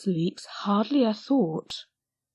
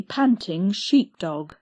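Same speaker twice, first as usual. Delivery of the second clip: ordinary delivery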